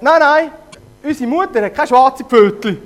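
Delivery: high, loud no no; young goats catching out the wolf; indignant kids. Acted. Kids reject wolf's paw.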